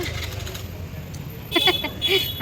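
long high-pitched laugh; laughter. Real, not acted. Parent laughing while teasing the child about a sore throat, high giggly laugh. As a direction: burst out laughing and let it run on. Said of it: "হাসছি, মুখ চোখ বন্ধ করে নে".

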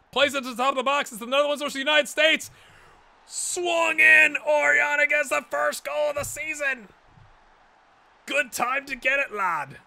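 high, loud, fast goal call; rising to a full-throated goal shout; live play-by-play as the goal goes in; excited commentary. Real, not acted.

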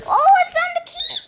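high, loud delighted cry; excited squeal. Real, not acted. Player finds the key in a maze, excited squeal. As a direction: squeal at a lucky find.

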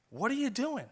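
a king shocked by his men's risk; startled rebuke. Retold or imitated. David rebukes his loyal men.

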